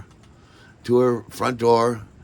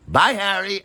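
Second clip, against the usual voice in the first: Maggie's voice, imitated; 11 semitones higher, 3 dB louder than usual. Maggie saying goodbye.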